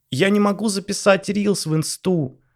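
high, slow whiny wail; wailing young wife. Acted. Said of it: "голосила полуобнажённая Ванесса".

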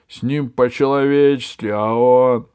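indignant, voice rising loud; indignant dragon. A narrator's reading.

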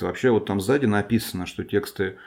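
ordinary delivery

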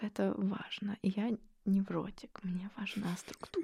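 hushed whisper. Real, not acted. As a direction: whisper it like a secret. Whispered self-mocking confession.

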